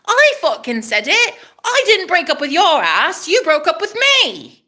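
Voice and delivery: high, drawn-out protest; high-pitched, exasperated comeback; indignant outburst. Real, not acted. Venting at ex's words.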